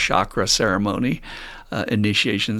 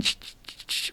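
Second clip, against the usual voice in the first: short "ch" bursts; repeated voiceless shushing sounds; imitated sand-tool noise; 11 dB quieter than usual. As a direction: imitate sand being scraped out line by line. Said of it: "these guys are bent over, going".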